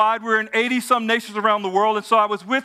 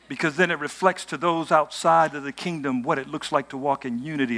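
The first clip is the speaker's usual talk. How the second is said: lower, settled sermon voice; quiet preaching; 7 semitones lower, 3 dB quieter than usual. Acted. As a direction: bring it down and speak it earnestly. Softer reflective preaching.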